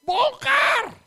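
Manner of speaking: bellowed command; shouted order. Retold or imitated. Pastor bellowing to tear down her gift. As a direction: roar the order.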